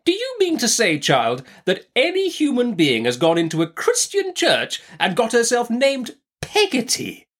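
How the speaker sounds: high-pitched, incredulous aunt; indignant. A narrator's reading. Aunt objects to servant's unchristian name, indignant. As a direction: protest in disbelief, pitched right up. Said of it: "with some indignation".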